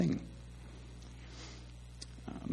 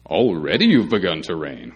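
sarcastic; 2 semitones lower, 6 dB louder than usual. Retold or imitated. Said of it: "Paul was being sarcastic".

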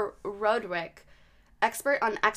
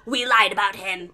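possessed woman imitation; 6 dB louder than usual. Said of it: "And she goes".

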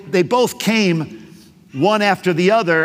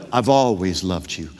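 much lower, softer, unhurried reassurance; reassuring God; 12 semitones lower, 4 dB quieter than usual. Acted. God reassuring the doubting believer, low and tender. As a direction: lay it down low like a hand on a shoulder. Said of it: "he wants to reassure you".

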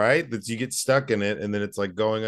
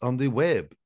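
put-on curious listener with an Eastern Bloc accent; 5 semitones higher than usual. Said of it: "they're like".

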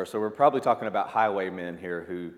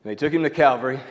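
ringing proclamation; 5 semitones higher, 10 dB louder, faster than usual. Acted. Preacher climax after pause.

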